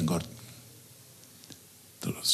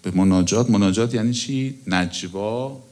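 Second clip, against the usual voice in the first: ordinary talk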